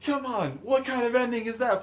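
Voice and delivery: high-pitched indignant complaint, imitating a spectator; mock yelling. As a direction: holler it high like a cheated audience member. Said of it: "guy in the wheelchair starts yelling".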